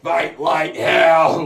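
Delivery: slow, high, loud mock rally cry; hammed-up stage yell, drawn out; Donald Trump rally shout. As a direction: bellow it like a politician whipping a crowd. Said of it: "he goes up on stage and he's like".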